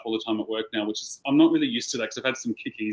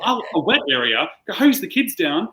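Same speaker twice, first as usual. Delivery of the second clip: put-on British accent; 6 semitones higher, 5 dB louder than usual. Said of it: "'cause she's British".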